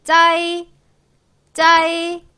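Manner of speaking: 짜이 high and loud with the weight on 아; pinyin zai demo. Shown as a demonstration. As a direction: punch out 짜이 as one syllable. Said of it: "쯔, 아이".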